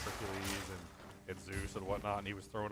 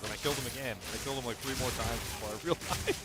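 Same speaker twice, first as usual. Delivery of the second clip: normal talking voice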